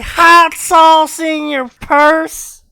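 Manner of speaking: loud, high-pitched needling; mocking question. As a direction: belt out the question to tease. Teasing with a quoted catchphrase.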